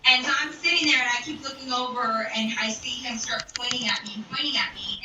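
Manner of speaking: ordinary delivery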